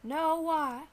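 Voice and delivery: high-pitched, defensive character; indignant high little voice; womanish sidekick voice. Acted. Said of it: "You sound a lot like one".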